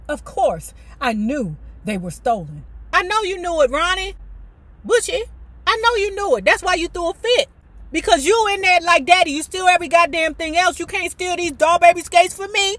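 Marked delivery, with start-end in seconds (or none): 2.9-12.8 loud, high teasing taunt; teasing call-out. Real, not acted. Host teasing the author about the tantrum, loud taunt. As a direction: rib her playfully for faking. Teasing Ronnie, voicing her.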